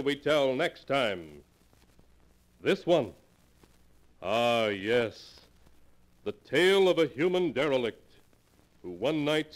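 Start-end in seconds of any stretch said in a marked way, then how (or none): none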